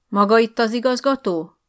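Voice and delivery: loud, clipped officer's question; commanding demand. Acted. Uniformed officer demanding the headmaster, loud commanding. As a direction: demand it like you own the room.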